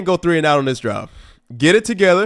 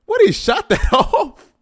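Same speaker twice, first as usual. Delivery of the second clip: laughing disbelief; 13 semitones higher, slower than usual. Real, not acted. Laughing at mistaken kickoff.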